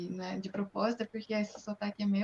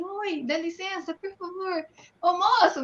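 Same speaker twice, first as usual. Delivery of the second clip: typical Brazilian asking; 8 semitones higher, 4 dB louder than usual. Retold or imitated. [name] shows how a Brazilian asks a stranger, high polite call. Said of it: "Não é assim".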